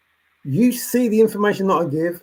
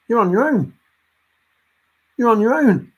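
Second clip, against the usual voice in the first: stern raised warning; 7 semitones higher, 5 dB louder than usual. Acted. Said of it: "So then I will just say".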